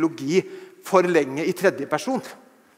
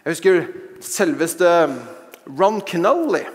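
ordinary delivery

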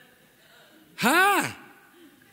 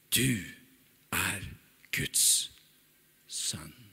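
solemn whisper; 8 semitones lower, 7 dB quieter, much slower than usual. Acted. Solemn confession.

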